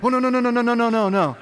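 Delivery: head off the tattling in a mock panic; panicked pleading. Brother stopping him telling Mom.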